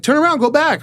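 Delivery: high, brisk imitated command; gym guy's order. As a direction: bark it like a young trainer. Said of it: "He's like".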